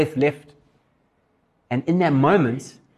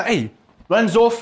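ordinary talk, nothing marked

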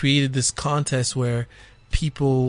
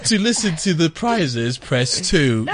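slow, recorded menu announcement; phone menu voice; 4 semitones higher, 5 dB louder, slower than usual. Pretend. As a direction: read it like an automated hotline.

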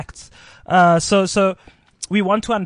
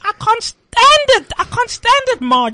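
mock panicked housewife; 6 dB louder than usual. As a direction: ham up a fretting suburban wife. Joins the mock panicking couple.

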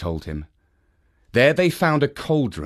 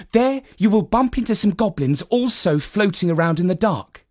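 put-on higher, gentle instructing girl; lightly voiced wise girl; 8 semitones higher than usual. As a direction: calmly tell him the plan. Finette instructs the boy.

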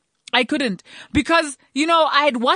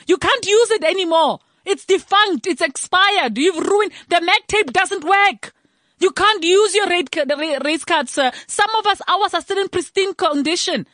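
higher, sharp scolding rant; exasperated telling-off, pitched up; heated scolding; 6 semitones higher than usual. Real, not acted. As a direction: tear into him, pitch climbing. Angry rant at the president.

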